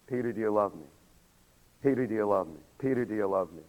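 low, soft, patient question; gentle questioning. Retold or imitated. The Lord checking with Peter, low and gentle. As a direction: ask it softly, almost privately.

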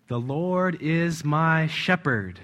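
slow, deliberate The Lord is my shepherd; willpower. Shown as a demonstration. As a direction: weigh each word of "The Lord is my shepherd" slowly. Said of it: "say the words over to yourself with all willpower".

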